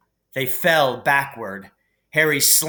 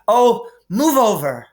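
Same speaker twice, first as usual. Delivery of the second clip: impatient, louder, higher snarl; 9 semitones higher, 6 dB louder than usual. A narrator's reading. Hermione, impatient with the boys at the locked door, snarls louder. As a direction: shove the words out in irritation. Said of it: "Hermione snarled".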